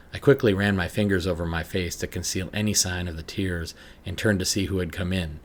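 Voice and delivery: ordinary talk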